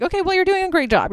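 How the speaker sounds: high, rushed retold praise; mock placating praise. Man placating the masked friend blocking the door, mock placating.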